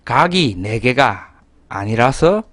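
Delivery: ordinary talk, nothing marked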